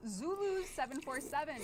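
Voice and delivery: high, bright winner reveal; excited announcement. Real, not acted.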